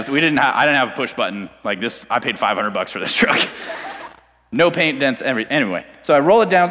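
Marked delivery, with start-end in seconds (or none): none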